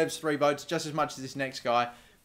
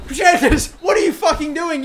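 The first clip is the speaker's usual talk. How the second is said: loud, much higher exasperated yell; angry shout; 12 semitones higher, 9 dB louder than usual. Real, not acted. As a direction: yell at the striker for standing still. Striker stood still, anger.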